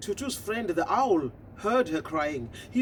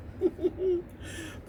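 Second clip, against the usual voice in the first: mocking laugh; 11 semitones higher, 6 dB quieter than usual. Toads laughing.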